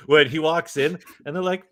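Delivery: ordinary delivery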